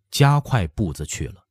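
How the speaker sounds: plain reading voice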